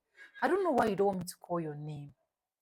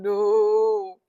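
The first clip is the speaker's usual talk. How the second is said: retold scream; 12 dB louder than usual. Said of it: "I was screaming".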